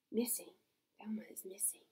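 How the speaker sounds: hushed whisper, no voice; whispered poster. Acted. Reading the missing poster to kids, whispered. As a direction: breathe the words like a secret.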